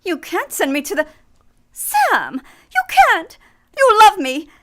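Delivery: frantic, loud begging; cling to him, crying out in desperation; woman desperately pleads with the detective not to turn her in; desperate pleading cry. Acted. Woman begs not to be turned in.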